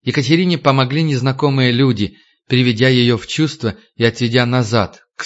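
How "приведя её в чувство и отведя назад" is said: usual speaking voice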